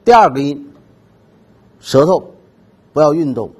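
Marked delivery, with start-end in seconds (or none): none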